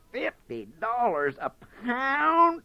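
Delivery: high, slow astonished gasp. Acted. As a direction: gasp at the price.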